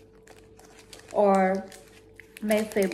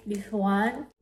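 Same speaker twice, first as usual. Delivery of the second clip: ordinary delivery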